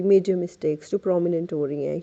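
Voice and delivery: ordinary talk, nothing marked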